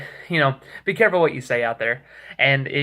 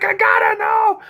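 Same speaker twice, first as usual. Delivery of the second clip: slow, high, loud desperate yell; hammed-up frantic shout, much higher and louder; 6 dB louder than usual. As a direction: yell it like you're losing your mind. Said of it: "losing his mind".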